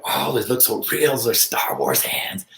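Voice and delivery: skeptical onlooker, dropping to a whisper on the last words; mock doubting audience. Imitating doubters in the crowd who think his hands are fake, sneering whisper. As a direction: play a doubter who sneers at the injury, then whispers the punchline.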